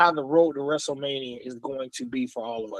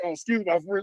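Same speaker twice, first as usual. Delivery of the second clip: usual speaking voice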